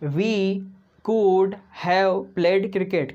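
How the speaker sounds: very slow, stretched English; teacher modelling slowly; slow model sentence. Shown as a demonstration. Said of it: "क्या होगा दोस्तों?".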